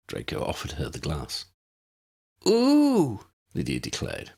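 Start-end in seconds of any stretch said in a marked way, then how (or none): 2.5-3.3 excited ooh. A narrator's reading. Lydia voiced by the reader.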